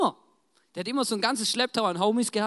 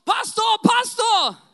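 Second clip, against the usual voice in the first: full-voiced, very high yell; sudden shout; 12 dB louder, much slower than usual. Churchgoer interrupts the pastor mid-sermon, shouting. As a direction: yell across the room to interrupt. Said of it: "plötzlich mitten in der Predigt steht einer auf".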